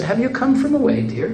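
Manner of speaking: a high, sweet Newfoundland greeting; sweetly. Retold or imitated. Church greeter asks the visiting wife, mock sweetly. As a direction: coo it kindly like a church lady. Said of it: "looked at my wife real sweetly".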